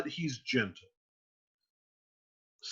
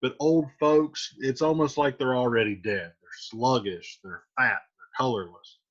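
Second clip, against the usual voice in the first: ordinary delivery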